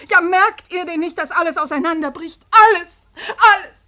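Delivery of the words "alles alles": loud, higher, anguished; anguished cry. Acted. Daughter crying out to her family in anguish. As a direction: cry it out in despair.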